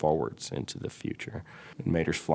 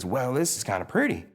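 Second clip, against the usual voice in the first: Mater voice; 7 semitones higher than usual. Acted. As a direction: play it like Mater, pleasantly surprised. Mater voiced in pitch story.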